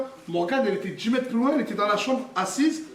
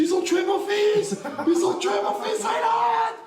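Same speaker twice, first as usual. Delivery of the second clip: panicked screaming; 13 semitones higher, slower than usual. Retold or imitated. Father in a paranoid panic at the clinic, high screaming. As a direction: scream it as if your son is gone. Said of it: "je pars dans une crise de paranoïa".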